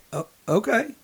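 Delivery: softly imitated, taken-aback okay; meek reply. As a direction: imitate a quiet, unargumentative reply. Retelling man's meek reply to scolding.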